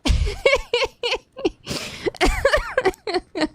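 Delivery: long, very high giggling fit; helpless laughter. Real, not acted. Cracking up at a viewer's reaction.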